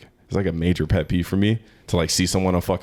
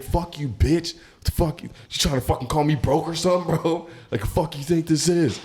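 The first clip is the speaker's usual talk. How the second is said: mock furious cursing; 9 semitones higher than usual. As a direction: imitate an offended customer going off. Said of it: "just cursing and going the fuck off".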